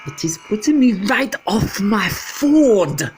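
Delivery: high, loud, slow disgusted witch rant; screaming witch. Acted. Grand High Witch raging at witches about children, shrieking. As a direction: build your revulsion at the children. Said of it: "she screamed".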